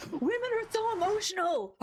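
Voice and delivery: high, loud mock voice of a smug guy; mocking sexist guy. Mocking guys who call women emotional, high mock voice. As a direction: ham up a whiny know-it-all. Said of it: "guys who are like".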